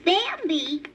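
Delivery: high little rabbit asking; baby rabbit voice. A narrator's reading. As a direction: voice a small eager bunny. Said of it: "repeated the rabbit".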